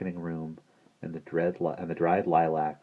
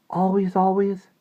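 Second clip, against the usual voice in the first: put-on high, loud little-girl voice; child's eager question; 14 semitones higher, 7 dB louder than usual. A narrator's reading. Little sister seeks reassurance from Maggie, high childlike question.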